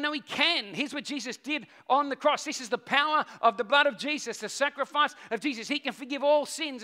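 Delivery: put-on retelling a young man pleading hard; earnest pleading.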